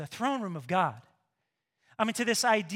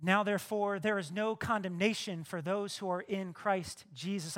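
ordinary delivery

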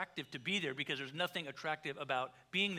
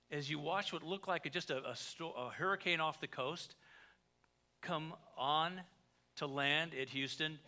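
ordinary delivery

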